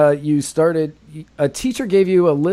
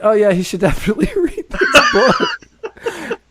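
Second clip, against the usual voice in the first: laughing sarcastic reply; 13 semitones higher than usual. Retold or imitated. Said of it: "I went".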